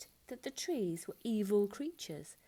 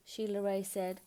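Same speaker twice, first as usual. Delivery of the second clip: plain reading voice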